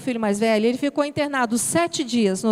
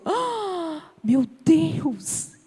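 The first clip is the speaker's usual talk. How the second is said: retold dismay; much slower than usual. Woman recalling a hurtful birthday message, dismayed.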